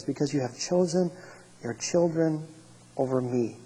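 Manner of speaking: quiet, weighty judgment; grave verdict. Acted. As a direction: pronounce it softly and solemnly, with pauses. God's grave verdict on Eli.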